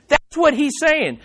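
usual speaking voice

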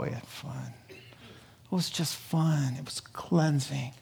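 slow soft whisper; whispered fondness. Real, not acted. Preacher recalling the record smashing, whispered fondness. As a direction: savour the memory in a hush. Fond hushed recollection.

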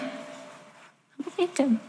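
"believed" said slowly and softly, voice high and fragile; soft, breaking repeat. Real, not acted. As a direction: let "believed" fall away quietly, hurt. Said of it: "And I believed him".

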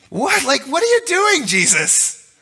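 incredulous disciples. Retold or imitated. Disciples shouting at sleeping Jesus.

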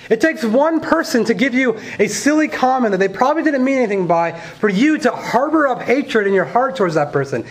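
preaching. Acted. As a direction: preach it with rising passion. Preaching builds in force.

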